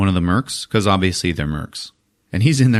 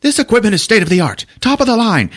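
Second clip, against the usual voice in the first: mock proud officer; 13 semitones higher, 4 dB louder, faster than usual. Officer boasting in movie.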